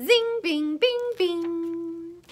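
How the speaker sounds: high ringing zing and bing; ringing cymbals. Pretend. Reader imitates the fly's cymbals, ringing voice. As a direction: let each word ring like a cymbal. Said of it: "made his cymbals ring".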